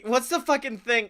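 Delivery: ordinary delivery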